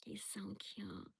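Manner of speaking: slow, hushed whisper; very soft, drawn-out murmur; adoring whisper. Real, not acted. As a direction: whisper it slowly, adoring the dog. Murmuring about her dog.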